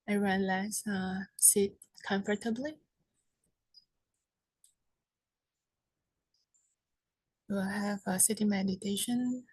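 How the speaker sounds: soft, unhurried meditation guidance with long pauses; guided meditation. Real, not acted. Teacher guides seated group to relax the body, soft meditation voice. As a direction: settle them gently, leaving long silences between phrases.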